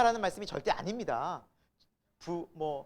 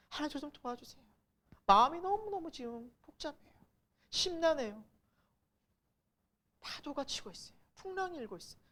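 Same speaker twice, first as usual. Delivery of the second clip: high, thin, softened pleading voice; pleading prayer; 8 semitones higher, 3 dB quieter than usual. Acted. Preacher acting out a prayer to God for help, pleading. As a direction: plead to God from a troubled heart. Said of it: "하나님께 기도할 수 있어요".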